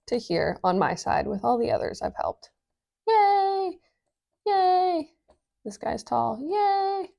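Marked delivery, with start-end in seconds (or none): 3.1-5.1 squeaky high cheer; high-pitched cartoon yay. Acting out helped people cheering. 6.4-7.1 squeaky high cheer; high-pitched cartoon yay. Acting out helped people cheering.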